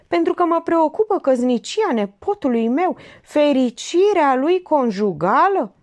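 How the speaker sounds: high, loud, emphatic protest; insist, drawing out the last words; indignant uncle. Acted. Uncle troubled over his nephew's marriage, indignant.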